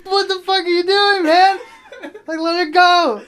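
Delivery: very high, loud frantic protest; alarmed shouting. Retold or imitated. Friend to the kid holding the cousin, alarmed shouting. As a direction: yell in outraged disbelief.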